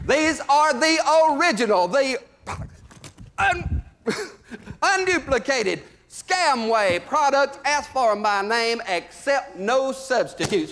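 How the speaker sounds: high, loud hard-sell huckster; pitchman voice. Acted. Comic selling Scamway to the crowd as a loud huckster. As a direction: bark the pitch like a carnival barker. Salesman launches product pitch.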